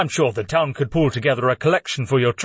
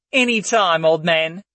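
cheeky quip; 4 semitones higher than usual. A narrator's reading. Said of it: "Layla quipped with a cheeky grin".

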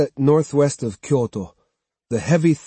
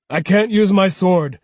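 louder, firm refusal; 6 semitones higher, 5 dB louder than usual. Acted. Swordsman refusing to draw, louder and firm. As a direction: state it flatly and firmly.

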